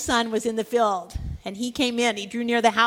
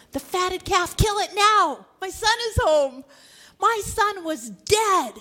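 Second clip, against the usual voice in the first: raised, loud, ringing orders to the servants; joyful father's command; 7 semitones higher, 5 dB louder, slower than usual. Acted. Overjoyed father calling to his servants: loud command. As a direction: call out the news like an overjoyed father.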